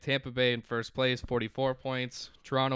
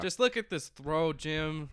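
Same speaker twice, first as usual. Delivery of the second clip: Southern Tony Romo; 5 semitones higher, slower than usual. Retold or imitated. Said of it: "doing Southern for Tony Romo".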